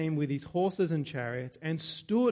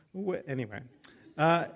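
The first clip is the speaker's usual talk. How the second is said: usual speaking voice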